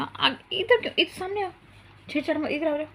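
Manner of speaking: high sing-song coaxing; coaxing call. Real, not acted. Mother coaxing her son to come in front, high call.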